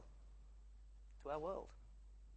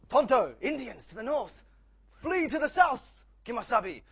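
urgent heroic shout, much higher and louder; Lone Ranger; 9 semitones higher, 14 dB louder than usual. Acted. Cowboy hero warns his companion of an ambush, mock-urgent shout. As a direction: call the alarm like a hammed-up cowboy hero. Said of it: "Lone Ranger goes".

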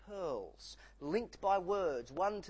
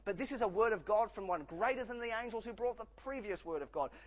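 ordinary delivery

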